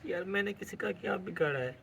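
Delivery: soft, wounded crying voice; hurt and quietly weeping; tearful. Real, not acted. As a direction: protest weakly through tears. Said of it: "اس میں رونے والی کون سی بات ہے".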